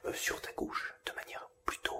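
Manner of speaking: soft whisper close to the left ear. Shown as a demonstration. Binaural demo, speaking on the left.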